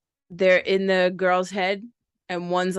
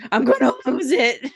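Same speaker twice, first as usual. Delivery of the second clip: laughing; 5 semitones higher, 4 dB louder than usual. Retold or imitated. Repeat while laughing.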